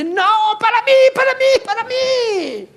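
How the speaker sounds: high, loud whiny devil crying mine. Pretend. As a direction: squeal it like a greedy spoiled devil. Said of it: "Y el diablo dice".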